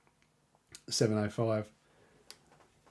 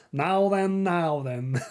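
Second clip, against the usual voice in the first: dim pompous cartoon cop; 4 semitones higher, much slower than usual. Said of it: "look, that's pretty eerie".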